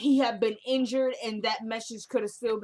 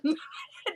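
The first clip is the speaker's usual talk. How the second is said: short laugh; 7 semitones higher than usual. Real, not acted. Laughing at own joke.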